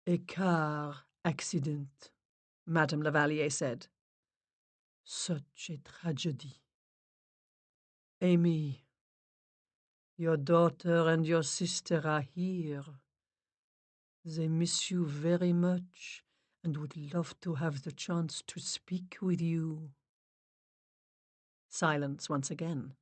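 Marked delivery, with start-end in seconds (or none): 0.1-2.1 put-on slow, accented séance medium; French medium, sympathetic. A narrator's reading. Medium sensing the death, slow sympathetic voice. 5.0-19.9 put-on slow, soft accented séance voice; French medium, sympathetic. A narrator's reading. Medium telling the family spirits are here, slow sympathy.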